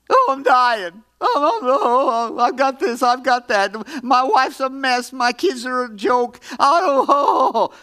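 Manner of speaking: exaggerated woe-is-me wailing; mock moaning complainer. Preacher mimicking people who talk about their problems, mock moaning.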